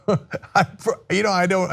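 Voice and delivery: ordinary talk, nothing marked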